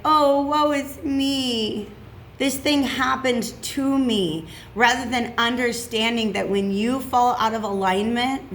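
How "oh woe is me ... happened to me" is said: slow, drawn-out self-pitying whine, pitched up; mock woe. Reader mocking her own victim mentality, hammed-up woe. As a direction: ham up the poor-me victim lament. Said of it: "my own backslide into victim mentality".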